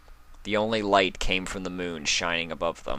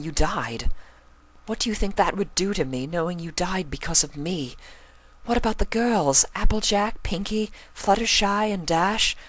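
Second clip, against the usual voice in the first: much higher, urgent and emotional plea; pleading Rarity; 10 semitones higher than usual. Acted. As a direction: list their friends to make him see.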